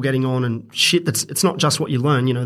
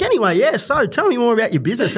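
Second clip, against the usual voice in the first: breezy mock casual; 6 semitones higher than usual. Imitating her breezy chat.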